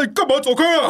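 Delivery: high, indignant telling-off, played for laughs; imitated angry outburst. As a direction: snap at a kid, imitating with a laugh. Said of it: "他就大生气".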